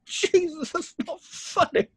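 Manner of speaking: high, slow laughing groan; giggling protest through a covered nose; laughing disgust. Acted. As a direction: laugh while gagging at the smell. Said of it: "[name] laughs ... Sean winces".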